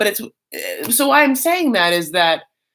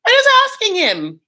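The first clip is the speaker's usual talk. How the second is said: much higher, louder mock sister; sister's voice; 13 semitones higher, 5 dB louder than usual. Sister pushing Darcy to ask, high squeal. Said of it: "She's like".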